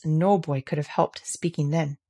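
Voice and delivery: ordinary delivery, nothing marked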